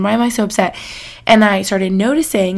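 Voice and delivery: normal talking voice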